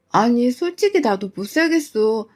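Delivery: ordinary delivery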